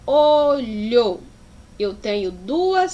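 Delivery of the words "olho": "olho" in two long, separate syllables; each syllable of "olho" stretched out. Shown as a demonstration. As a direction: break "olho" into o, lho. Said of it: "Na separação de sílaba da palavra".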